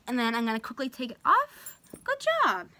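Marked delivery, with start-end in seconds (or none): 2.0-2.7 much higher, excited praise for the dog; praising the dog. Real, not acted. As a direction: cheer him on in a high voice. Praising dog as muzzle comes off.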